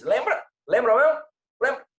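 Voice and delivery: high playful prodding; teasing. Real, not acted. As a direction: tease them about remembering. Teasing check on memory.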